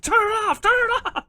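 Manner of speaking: high-pitched mock-panicked yelling. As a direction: imitate a confident man suddenly breaking down in panic. Retold breakdown, begging to shut off.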